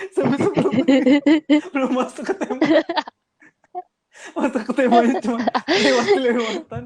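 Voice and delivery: words broken up by giggles; laughing. Real, not acted. Guest joking to co-hosts about a clumsy topic change, laughing. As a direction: talk through real laughter.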